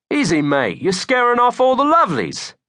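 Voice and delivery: high, cartoonish fake British accent; Jezza. A narrator's reading. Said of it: "you're not British".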